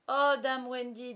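high, loud, drawn-out plea; imploring chorus of lost boys; pleading children. Acted. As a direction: implore her slowly, like eager children. Children begging Wendy to mother them.

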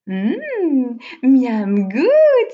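savouring hum, then loud yum; loud, relishing cry of delight; delighted exclamation. A narrator's reading. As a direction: exclaim over a delicious mouthful. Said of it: "s'excria-t-elle".

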